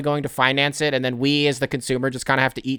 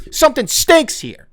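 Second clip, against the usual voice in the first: higher, heated repeat; angry; 12 semitones higher, 4 dB louder than usual. Real, not acted. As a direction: spit it out, fed up. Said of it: "I'm mad about all of it".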